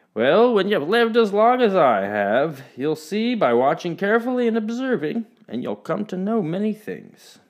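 wise grandpa. A narrator's reading. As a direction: share a lesson kindly. Grandpa gives wise advice.

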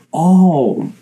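"all" as a long open "aw" sound; drawn-out vowel. Shown as a demonstration. Host shows the little sound in Crom, stretched open vowel. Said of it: "they have that little".

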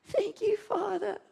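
high, slow teary thanks; laugh-cry to the Father. Retold or imitated. Tearful thanks to God.

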